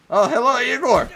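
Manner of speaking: loud high silly greeting; high put-on greeting. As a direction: greet like a cartoon.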